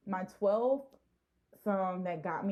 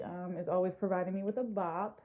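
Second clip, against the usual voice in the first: ordinary talk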